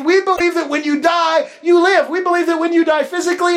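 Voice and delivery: full-force pulpit shout; preaching shout. Shown as a demonstration. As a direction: proclaim it to the back row. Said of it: "let me say it like this".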